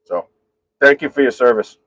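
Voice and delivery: normal talking voice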